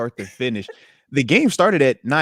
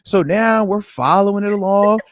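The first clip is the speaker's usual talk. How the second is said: normal talking voice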